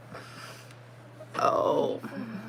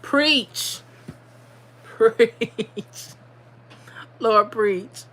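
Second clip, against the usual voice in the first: preach; 2 semitones higher, much slower than usual. Real, not acted. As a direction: shout amen to the comment. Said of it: "pride cometh before the fall".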